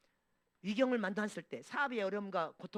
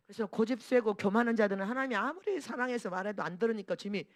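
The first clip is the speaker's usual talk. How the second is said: ordinary talk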